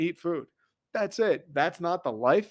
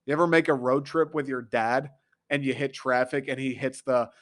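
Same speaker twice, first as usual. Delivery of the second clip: ordinary talk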